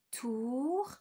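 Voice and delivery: "Tournevis" broken into three, with long gaps; syllable by syllable. Shown as a demonstration. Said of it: "On vérifie". (« Tournevis » très découpé, syllabe par syllabe, s final prolongé)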